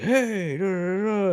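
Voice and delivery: high, drawn-out imitated "hey"; mock greeting. Customer greeting the shopkeeper, imitated high and chatty.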